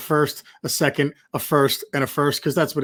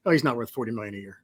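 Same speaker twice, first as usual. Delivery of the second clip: mocking critics; 6 semitones lower, 8 dB quieter, faster than usual. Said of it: "just look at the stats and say".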